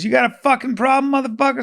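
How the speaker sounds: hammed-up angry driver; mock tough guy. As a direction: play the big tough guy yelling from his car. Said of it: "And he goes".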